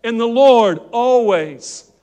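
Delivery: slow, loud, drawn-out proclamation; preached scripture. Acted. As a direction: stretch each word of the verse out loud. Preacher reads scripture with force.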